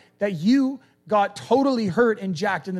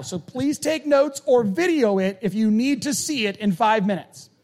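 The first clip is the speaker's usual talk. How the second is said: emphatic; 6 semitones higher, 5 dB louder than usual. Real, not acted. Insistent warning to congregation.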